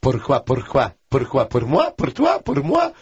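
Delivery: "pourquoi, pour moi" chanted, climbing high; tongue-on-palate drill. Acted. Translator showing off a warm-up, climbing "pour moi" drill. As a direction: drill "pourquoi" and "pour toi" rhythmically, rising in pitch. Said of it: "the tongue on the palate one".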